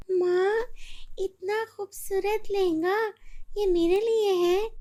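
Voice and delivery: put-on high, amazed little girl voice; a child gasping at a gift; girl thrilled by the dress, high child voice; delighted girl. Child sees the lehenga.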